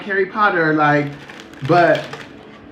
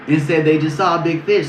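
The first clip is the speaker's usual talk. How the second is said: ordinary talk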